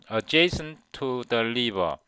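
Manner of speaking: Adjacent to the river said very slowly with the T silent; dropped T, slow demo. Shown as a demonstration. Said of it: "이 T는 발음이 거의 안 들려요". (T 없이 천천히 어제이슨 투 더 리버)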